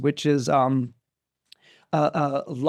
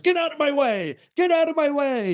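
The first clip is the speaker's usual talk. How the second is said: mock yell; 10 semitones higher than usual. Charioteer racing at a man in his path, mock yelling. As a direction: call out urgently in a high voice. Charioteer yells at Oedipus to move.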